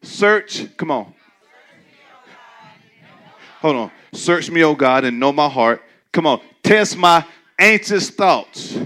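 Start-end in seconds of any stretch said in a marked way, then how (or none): 0.0-0.8 leading reading. Shown as a demonstration. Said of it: "Let's read this. Ready?". 4.1-8.8 leading scripture. Shown as a demonstration. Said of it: "Let's read this".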